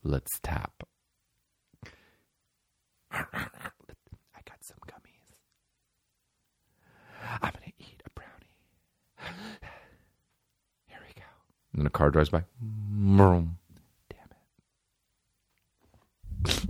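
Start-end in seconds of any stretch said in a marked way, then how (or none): 0.0-0.8 lower, softer imitated kid; a hint of a kid with an idea; quiet voiced kid; hushed kid. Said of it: "some kid was like". 3.1-11.2 barely voiced ASMR whisper; mock ASMR snacking whisper. Continuing ASMR whisper parody. 12.6-13.6 imitated car engine vroom; mock passing car noise; lightly voiced car sound.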